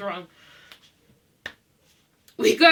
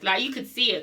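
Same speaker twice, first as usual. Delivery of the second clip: usual speaking voice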